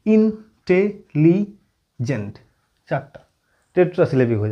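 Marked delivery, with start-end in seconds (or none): 0.0-2.4 "intelligent" split slowly into in, te, li, jent; teacher counting out the syllables of "intelligent", very slowly. Shown as a demonstration. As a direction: break "intelligent" into four separate syllables with clear gaps. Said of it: "এটা অনেকগুলো syllable বেরিয়ে যাবে".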